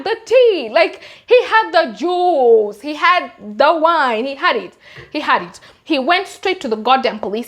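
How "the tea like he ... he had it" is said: high, loud, slow spilling of tea; gleeful gossip. Real, not acted. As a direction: savour every word like juicy gossip. Gleeful gossip about the journalist.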